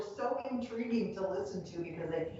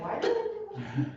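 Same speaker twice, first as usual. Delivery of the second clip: puzzled; 14 semitones higher than usual. Retold or imitated. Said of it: "And I'm always like".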